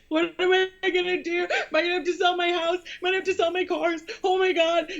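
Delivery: high, fast, tearful wail ending in a cry of despair; retold sobbing. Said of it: "like, sobbing".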